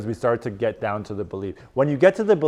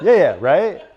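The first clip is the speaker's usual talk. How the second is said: louder, laughing through it; laughing along; 4 semitones higher, 6 dB louder than usual. Real, not acted. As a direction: laugh with the room. Laughing at student's answer.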